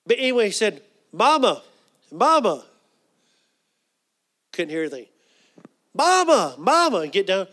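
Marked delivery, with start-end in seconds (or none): 1.1-2.7 raised, louder call to Mama. Retold or imitated. Trying to rouse sick mother, calling out. 5.9-7.0 high, loud calls to Mama; calling louder. Retold or imitated. Calling to mother who couldn't hear, raised voice.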